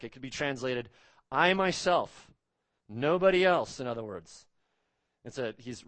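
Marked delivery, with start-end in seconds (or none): 1.3-3.8 "I myself" slow, loud and higher; emphatic I myself. Shown as a demonstration. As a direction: hammer "I myself", then "nobody else", with full force. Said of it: "it's emphatic".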